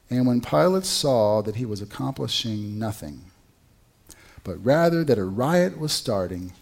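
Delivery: ordinary delivery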